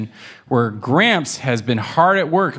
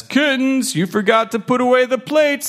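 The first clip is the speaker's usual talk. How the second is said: high calling out; calling grandpa; 12 semitones higher than usual. Acted. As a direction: call them back. Gramps calls the kittens back.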